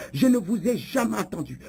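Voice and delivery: ordinary talk, nothing marked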